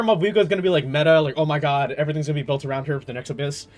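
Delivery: ordinary talk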